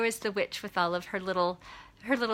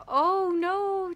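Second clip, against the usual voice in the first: high, drawn-out mock dismay; dismayed little critters; 11 semitones higher than usual. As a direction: imitate worried critters in a high voice. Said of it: "little critters are like".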